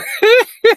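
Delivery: sudden high burst of laughter; delighted laugh. Real, not acted. Laughing at how fast it is now.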